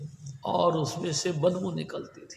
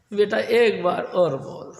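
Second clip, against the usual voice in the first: raised, insistent plea to say Ram once more; a mother coaxing a sick youth, louder; 5 dB louder, slower than usual. Acted. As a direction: push him gently but firmly. Mata Loi coaxes again.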